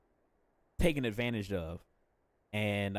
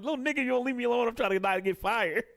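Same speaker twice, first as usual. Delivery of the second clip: stressed parent scolding; 11 semitones higher than usual. Retold or imitated. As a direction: rattle it off like a harried parent at work. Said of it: "I'm like".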